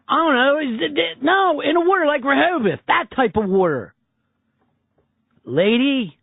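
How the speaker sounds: put-on high, whiny Philadelphia accent talking down to a confused dispatcher; exasperated Philly accent. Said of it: "that awful accent".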